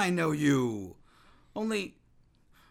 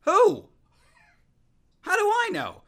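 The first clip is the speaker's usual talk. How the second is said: exasperated outburst; 9 dB louder than usual. Acted.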